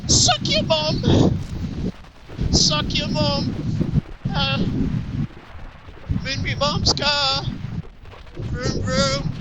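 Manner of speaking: high, slow British caricature; hammed-up high British voice; put-on British accent. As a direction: squeak out mock-British taunts. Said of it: "British thing".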